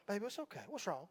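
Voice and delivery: soft hushed soothing; comforting the baby. Retold or imitated. Father soothing his crying baby daughter, soft hushed. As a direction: hush her gently, close to her ear.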